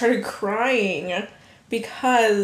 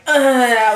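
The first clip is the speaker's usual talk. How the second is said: disgusted groan; 3 semitones higher, 9 dB louder than usual. Retold or imitated. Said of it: "then I'm like".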